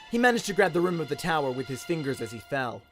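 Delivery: ordinary delivery, nothing marked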